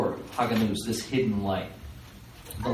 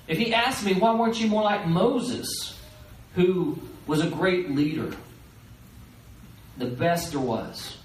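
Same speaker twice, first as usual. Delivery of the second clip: anxious rabbi; 6 semitones higher than usual. Retold or imitated. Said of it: "He says".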